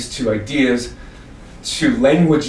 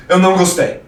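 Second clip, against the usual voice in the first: louder, higher Portuguese retort; father's Portuguese reply; 7 semitones higher, 6 dB louder than usual. Retold or imitated.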